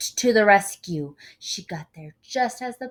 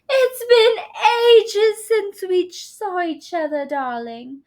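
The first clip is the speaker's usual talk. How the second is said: much higher, loud gushing star; gushing diva; 13 semitones higher, 11 dB louder than usual. A narrator's reading. Film star greeting Mister Sims, gushing diva voice. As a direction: gush over an old friend.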